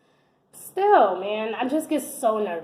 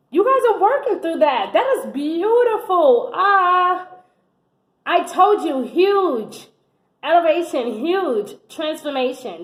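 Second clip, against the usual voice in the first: gushing, higher and louder; excited; 5 semitones higher, 4 dB louder than usual. Real, not acted. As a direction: let the joy lift the voice. Gushing over the reading.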